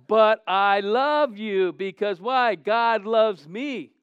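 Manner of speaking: slow, loud, every word hammered; slow loud emphasis. Acted. Pastor driving home his love to the church, slow and loud. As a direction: drag out "But I love you" word by word. Repeats line slowly.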